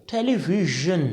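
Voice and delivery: "टेलीविजन" low, with soft zh as in vision; careful zh sound. Shown as a demonstration. Teacher modelling for learners, "टेलीविजन" with a zh. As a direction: say "टेलीविजन" as te-li-vi-zhun, neither z nor j. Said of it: "बहुत अजीब लगेगा आपको".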